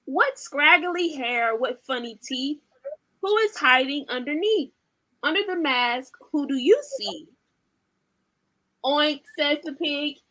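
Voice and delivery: lively, sing-song storybook reading; animated picture-book read-aloud; reading to children. Acted. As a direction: read it like bedtime for toddlers.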